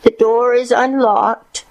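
high, slow granny voice; slow, high-voiced grandmother; frail grandmother. A narrator's reading. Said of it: "Grandmother responded".

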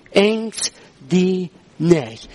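very slow, word by word; drawn-out, solemn quotation; emphatic scripture quote. Retold or imitated. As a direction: deliver each word separately, weightily. Preacher quoting God's word emphatically.

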